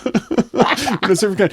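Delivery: high snooty voice, cracking up; laughing through it. Acted. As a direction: say it while laughing at your own joke. Repeated while laughing.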